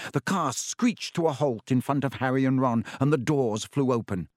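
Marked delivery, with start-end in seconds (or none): none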